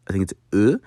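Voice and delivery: normal talking voice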